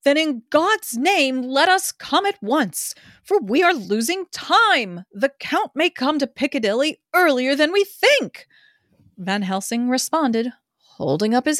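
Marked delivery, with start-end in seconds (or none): 0.0-8.5 raised pitch, pressing urgency; crying out urgently. A narrator's reading. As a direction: urge them to go now, high and eager. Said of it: "I started up crying out".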